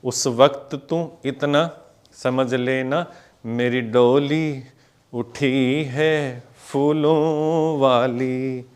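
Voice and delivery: slow, drawn-out lyric to the count; slow lyric recital again. Shown as a demonstration. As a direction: stretch each word out. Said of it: "फिर से देखो".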